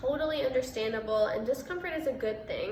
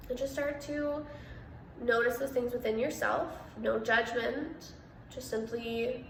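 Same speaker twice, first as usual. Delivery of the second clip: measured meditation voice with long pauses; guided meditation; 4 dB quieter than usual. Real, not acted. Meditation host guiding listeners through breath and body awareness, calm and spacious. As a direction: guide listeners into stillness, unhurried, pausing between phrases.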